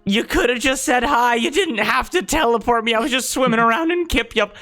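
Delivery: put-on high, anxious young voice; nervous high voice. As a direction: fret it out in a high voice. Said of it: "That's my nervous voice".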